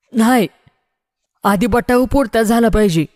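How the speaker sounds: high-pitched objecting villager; voice from the crowd. A narrator's reading. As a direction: call out a demand, pitched well up. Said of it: "समोरच्या बोळातून आवाज आला".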